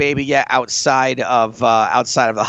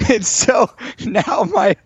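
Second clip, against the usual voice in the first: higher-pitched, laughing through the words; laughing hard; 9 semitones higher than usual. Real, not acted. Brother telling cohost about dad's naming blunder, laughing hard. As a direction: struggle to talk through real laughter.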